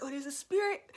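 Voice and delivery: retold amazement. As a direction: replay a surprised discovery. Retold reaction to niece's pointing.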